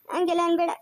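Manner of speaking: ordinary delivery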